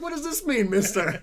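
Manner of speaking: loud laughing indignation; high indignant laugh. Retold or imitated. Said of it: "I'm like".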